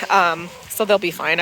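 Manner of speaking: ordinary talk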